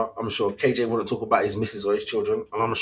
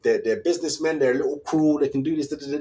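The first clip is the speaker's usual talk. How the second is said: ordinary talk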